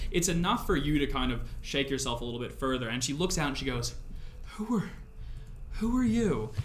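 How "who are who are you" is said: high, halting question; acted shock at seeing a stranger, voice pitched up; startled woman. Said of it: "possibly disgust fills her eyes".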